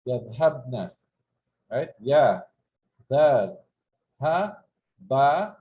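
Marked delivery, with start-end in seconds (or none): 2.0-5.6 each letter said apart and loud. Shown as a demonstration. Teacher spelling the verb for students, letter by letter. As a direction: sound out every letter. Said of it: "spelling letters for students".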